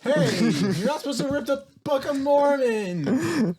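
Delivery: high, loud playful telling-off; mock scolding. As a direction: scold them half in jest. Scolding friend for ripping book.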